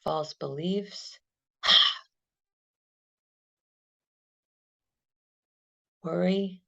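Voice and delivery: calm, unhurried meditation guide; guided meditation. Acted. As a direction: lead them gently through the breath. Guided body-scan meditation.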